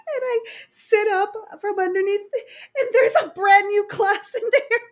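high, loud laugh-crying words; laughing hard. Real, not acted. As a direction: push words out through laughter. Laughing fit while telling story.

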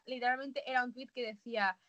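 usual speaking voice

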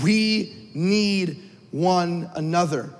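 "We need one another" drawn out very slowly, each word weighted; preacher slowing down to repeat the key line; slow emphatic repeat. Shown as a demonstration. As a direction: land it slowly, word by word, so it sinks in. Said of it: "I wanna say this again".

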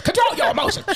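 shouted scolding. Retold or imitated. Stern nurse practitioner scolding.

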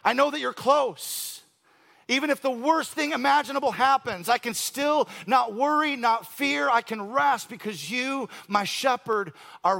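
a preacher building to a passionate peak; fervent preaching. Acted. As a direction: declare it to the room with full conviction. Preaching at full force.

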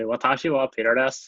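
Japanese said with a Northern Irish lilt; "Watashi wa Peter desu" in a Belfast twang, vowels broadened; Northern Irish accent on "Watashi wa Peter desu". Shown as a demonstration. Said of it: "if I was to say it in Northern Irish".

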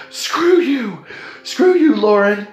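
high, playful mock outburst; a hammed-up, high-pitched screw-you; mock indignant. Traveller to gate agent Lauren, mock indignant.

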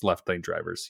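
ordinary talk

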